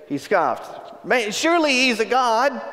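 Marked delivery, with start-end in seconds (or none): 1.0-2.6 higher sarcastic jab; mocking. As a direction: needle them with fake respect. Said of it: "Elijah began mocking them".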